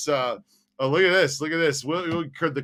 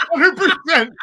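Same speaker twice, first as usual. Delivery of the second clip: high, loud burst of laughter on hundred; laughing hundred percent; 12 semitones higher, 8 dB louder than usual. Real, not acted. Laughing emphatic agreement.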